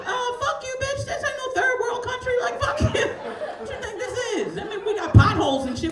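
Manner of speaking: high laughing mock outrage from the crowd; indignant Baltimore crowd. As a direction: squeal out the insult as offended locals. Acting offended Baltimore crowd.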